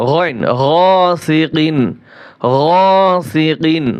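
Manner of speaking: গাসিকিন slow, with a clear কিন and no nasal hum; clear recitation. Shown as a demonstration. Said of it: "স্পষ্ট হবে". (স্পষ্ট উচ্চারণে ধীরে তেলাওয়াত)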